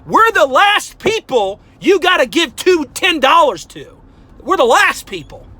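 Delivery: loud heated insistence. Real, not acted. Ranting at viewers that successful people need no gifts, loud. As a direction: hammer it home, angry. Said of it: "now I'm getting hot".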